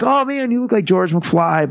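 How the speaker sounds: high-pitched imitation of amazed colleagues; mock-excited, high put-on voice; excited onlookers. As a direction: mimic them exclaiming, voice jumping high. Wrestlers reacting to his new look.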